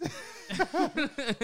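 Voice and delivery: high, loud real laugh; sudden loud laughing fit; burst of laughter. Real, not acted. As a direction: crack up at the joke. Laughing at stock joke.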